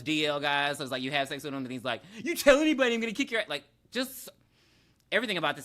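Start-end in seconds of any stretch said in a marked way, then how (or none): 2.2-3.5 high, loud retold threat; mock threat. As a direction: burst out with a hammed-up warning. Retold threat to keep a secret.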